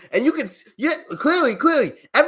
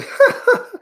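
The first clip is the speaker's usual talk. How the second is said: high wheezing laugh. Real, not acted. Streamer cracking up at a heckler, high laugh. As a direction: break off into a high, airy cackle. Cracks up while mocking the troll.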